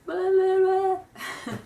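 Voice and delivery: normal talking voice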